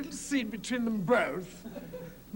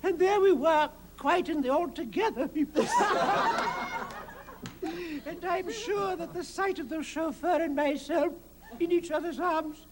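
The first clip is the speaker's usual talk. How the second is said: put-on high, fluttery old English lady; Margaret Rutherford impression; 4 semitones higher than usual. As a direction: play a dotty grand old English dame, rising higher. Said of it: "And she said".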